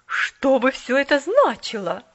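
a stern lady's loud question; stern demand. A narrator's reading. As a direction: mistress demanding from doorway. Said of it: "грозно прозвучал голос".

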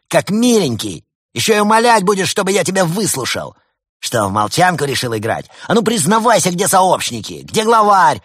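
high, loud spitting rant; bad cop tirade. A narrator's reading. Bad cop lieutenant rages at the arrested penguin. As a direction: hammer the suspect with furious questions. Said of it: "брызжущий слюной злой следователь".

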